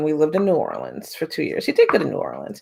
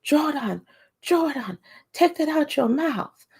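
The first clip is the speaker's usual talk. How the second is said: high-pitched little boy's mock yell; yelling three-year-old; 11 semitones higher than usual. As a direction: imitate a toddler scolding, voice pitched way up. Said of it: "my son starts yelling".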